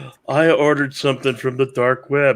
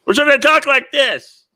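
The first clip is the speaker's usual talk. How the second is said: higher, louder showing-off voice; raised voice; 12 semitones higher, 5 dB louder than usual. Shown as a demonstration. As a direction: crank the voice up to prove you can. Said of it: "I can elevate my voice loud".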